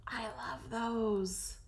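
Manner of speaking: slow, hushed whisper of love. Real, not acted. Crafter in awe of cloud drawings, slow whisper. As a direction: breathe it out in awe.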